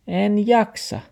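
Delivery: high dog voice; high whiny refusal. Acted. The dog refuses grandpa, high.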